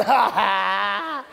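loud triumphant laugh; gloating laugh. Retold or imitated. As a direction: laugh out loud at the other team. Laughing after boasting.

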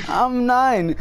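high, slow mock kid protest; whining child imitation. Friend voicing a nine-year-old answering a parent, mock whine. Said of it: "He's like".

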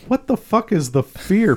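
high-pitched mock bafflement; incredulous quote, voice jumping high; retold baffled question. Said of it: "the way they comment on that".

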